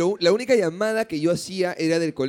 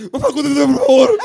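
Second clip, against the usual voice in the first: high, loud, laughing plea; pleading child; 9 semitones higher, 12 dB louder than usual. Retold or imitated. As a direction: beg dad through laughter. Kid begging on collect call.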